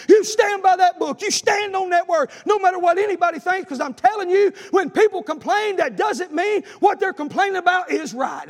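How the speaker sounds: loud, high, driving preacher's cry; preaching at full force. Acted. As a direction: hammer it home to the congregation.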